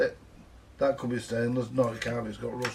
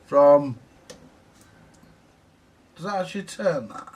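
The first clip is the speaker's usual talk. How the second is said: usual speaking voice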